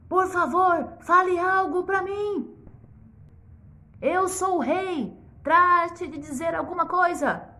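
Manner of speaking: high, loud furious demand; the king ordering a silent girl; red with rage. Acted. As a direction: force the words out in anger. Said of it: "Vermelho de raiva".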